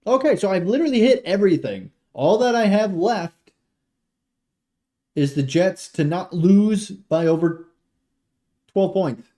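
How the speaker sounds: high, loud and elated; excited. Real, not acted. Streamer tallies bets after a hit, loud and excited.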